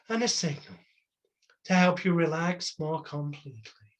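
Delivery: deepening induction voice. Shown as a demonstration. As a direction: drag it down into trance. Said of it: "the voice tone goes down, it becomes dragged out".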